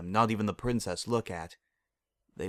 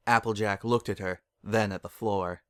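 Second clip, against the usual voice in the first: ordinary delivery, nothing marked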